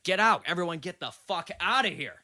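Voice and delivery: shouted high and loud, reliving the anger; retold angry outburst. Telling a friend how he yelled at the party guests, angry shout. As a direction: bark everyone out of the room. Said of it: "I got really mad".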